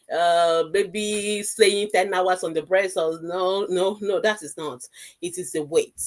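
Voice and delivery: ordinary delivery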